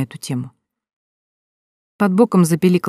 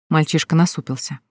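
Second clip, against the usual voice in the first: plain reading voice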